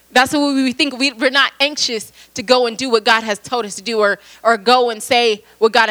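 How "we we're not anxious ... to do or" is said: ordinary talk, nothing marked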